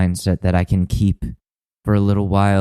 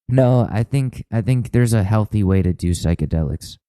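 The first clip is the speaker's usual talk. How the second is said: ordinary delivery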